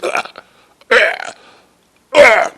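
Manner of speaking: high-pitched strangled croaks; mock dying gasps. Seller being choked by the mortgage man, mock dying gasps. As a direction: imitate someone being choked, in short bursts. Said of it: "looks like he's dying".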